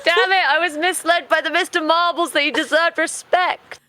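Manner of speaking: high-pitched protest through laughter; helplessly amused complaint; laughing exasperation. Real, not acted. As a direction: protest while recovering from a laughing fit. Breaking up laughing after her narration.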